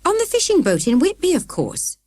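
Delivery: high, cheerful and bright. A narrator's reading. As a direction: chirp it out brightly. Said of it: "said Mother brightly".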